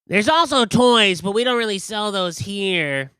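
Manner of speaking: high, loud puzzled merchant voice; high pushy seller; candy seller. Acted. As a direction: push candy on the customer. Seller mentions toys.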